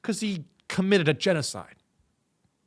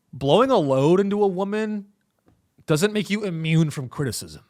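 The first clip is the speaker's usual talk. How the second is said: usual speaking voice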